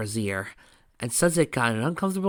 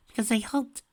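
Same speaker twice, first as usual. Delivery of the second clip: broken voice; 13 semitones higher than usual. A narrator's reading. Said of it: "she said in a broken voice".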